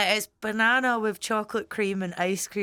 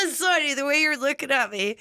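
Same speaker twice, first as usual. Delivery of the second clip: high laugh through apology; 5 semitones higher than usual. Real, not acted. Laughing at his face.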